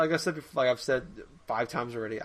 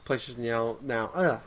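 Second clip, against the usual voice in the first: ordinary talk, nothing marked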